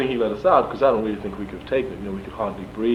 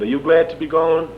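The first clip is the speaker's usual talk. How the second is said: raised, louder mock-sheriff question; imitating Sheriff Tyson; 6 semitones higher, 7 dB louder than usual. Sheriff sees the prisoner off, lightly imitated in higher voice. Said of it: "He said".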